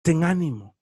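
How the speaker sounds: very low, solemn divine voice; voice of God. Acted. Preacher voicing God to the listener, deep and solemn. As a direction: speak it as God would, deep and grave. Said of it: "vas a oír a Dios decirte".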